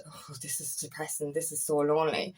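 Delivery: low, soft, deflated voice; lonely dismay. Retold or imitated. To herself at the bar at dinner, soft and deflated. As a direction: let it sink, quiet and lonely. Eating alone at the new bar.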